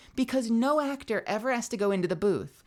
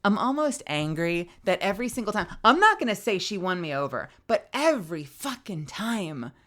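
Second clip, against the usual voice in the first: ordinary talk, nothing marked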